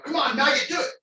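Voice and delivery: high loud barked insult; drill sergeant. Shown as a demonstration. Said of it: "getting yelled at".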